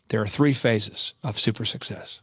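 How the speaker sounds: low, slow theatrical guru delivery; hammed-up imitation of a theatrical mentor; theatrical Michael Levine. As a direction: intone it low, with grand deliberate pauses. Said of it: "he talks like that, by the way, very theatrical".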